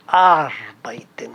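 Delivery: arbeiten drawn out slowly, long AR first; stress on AR. Shown as a demonstration. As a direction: lean on the AR of arbeiten, low and slow. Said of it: "A R".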